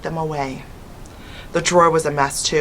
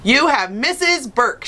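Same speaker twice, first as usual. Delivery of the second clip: shouting; 7 semitones higher, slower than usual. A narrator's reading. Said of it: "she shouted".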